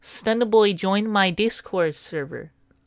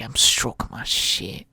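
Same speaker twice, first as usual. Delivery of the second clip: creepy low murmur; 9 semitones lower, 6 dB quieter than usual. Acted.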